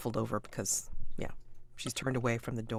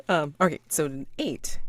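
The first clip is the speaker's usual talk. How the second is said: ordinary talk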